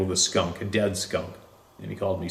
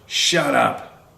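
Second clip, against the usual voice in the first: sharp mock shout, higher and louder; impatient shout; 5 semitones higher, 4 dB louder than usual. Stepfather cutting off the sobbing boy, sharp impatient shout. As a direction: cut the sobbing boy off hard. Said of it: "immediately gets impatient and interrupts".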